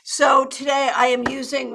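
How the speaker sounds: normal talking voice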